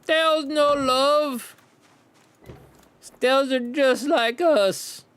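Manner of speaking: high, slow mocking voice; drawn-out high parody voice; mock silly voice. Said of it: "they'd be like".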